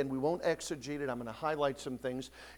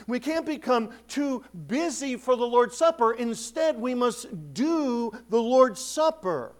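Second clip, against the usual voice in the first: higher, louder exhortation, slowing on must; preaching; 6 semitones higher, 6 dB louder, slower than usual. Acted. Pastor urging believers not to neglect the Supper, fervent preaching. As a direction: urge them, building.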